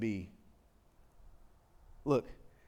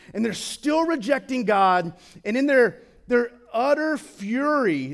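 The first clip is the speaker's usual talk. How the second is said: louder, insistent sermon, slowing on key phrases; full-force preaching; 7 semitones higher, 8 dB louder than usual. Acted. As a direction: hammer each torment home. Preaching on hell's torments.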